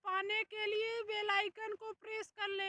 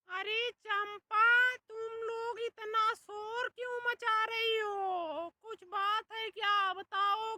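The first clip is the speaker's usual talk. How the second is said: loud demanding; 6 dB louder than usual. Acted. Man confronts two quarrelling women over their noise, loud demanding. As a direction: demand to know about the racket, loudly.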